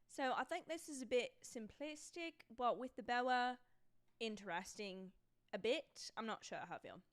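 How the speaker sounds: wobbly put-on British accent; mock British, carefully enunciated. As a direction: review it like an amateur British critic. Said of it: "I can't stick to a solid British accent".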